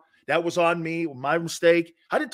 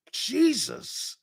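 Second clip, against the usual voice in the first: put-on girlfriend's shocked reaction; 7 semitones higher, 3 dB quieter than usual. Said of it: "My girlfriend looked at me and goes".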